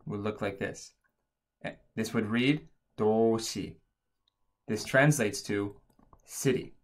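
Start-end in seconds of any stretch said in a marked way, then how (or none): 3.0-3.8 도시 said as doshi; Korean pronunciation. Shown as a demonstration. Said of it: "the S letter actually makes a sh sound".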